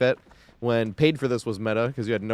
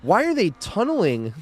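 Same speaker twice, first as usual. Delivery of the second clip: exasperated; 10 semitones higher, 3 dB louder than usual. Real, not acted. Exasperated at opponents.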